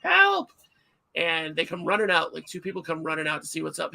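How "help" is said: high, loud panicked yell for help; re-enacted shriek for help at the zoo; screaming for help. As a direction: scream it at the top of your voice. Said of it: "like, top of my voice".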